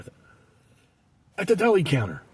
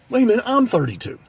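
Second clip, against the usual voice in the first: higher-pitched sudden objection; mock protest; 10 semitones higher, 3 dB louder than usual. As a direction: realise it and object, acted out. Customer objects on realising.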